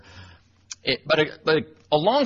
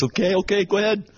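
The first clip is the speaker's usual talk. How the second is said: higher, humoring her with a light sing-song; mock placating reply; 8 semitones higher than usual. Sub humoring the angry custodian, mock placating. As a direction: play along, soothing her like a child. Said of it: "I'm in my, like, late forties".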